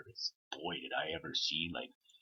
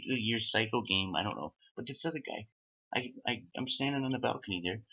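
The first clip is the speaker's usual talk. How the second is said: ordinary delivery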